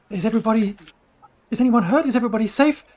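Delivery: high, urgent questions; Tali asking after everyone. Acted. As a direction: raise the voice, checking on everyone. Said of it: "she says".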